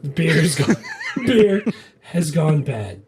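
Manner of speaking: slow quote broken up by laughter; laughing. Real, not acted. Repeating the movie line to co-host, slowly through laughter. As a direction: struggle to get it out through laughs.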